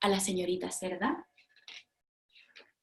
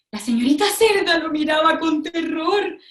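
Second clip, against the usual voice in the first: louder, higher, tense telling; dramatic narration; 5 semitones higher, 4 dB louder than usual. A narrator's reading. Narrator tells children how Cerda looked in terror.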